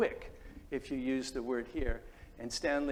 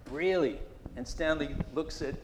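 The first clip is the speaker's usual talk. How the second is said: normal talking voice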